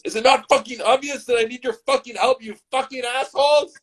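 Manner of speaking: high-pitched, hammed-up rant; furious screech. Shot man demanding help from the couple, furious high screech. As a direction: screech it in exasperated outrage.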